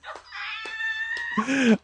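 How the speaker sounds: a very high shrieking burst of laughter; cracking up at the clerk's comeback, high shriek of laughter. Real, not acted. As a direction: squeal with laughter.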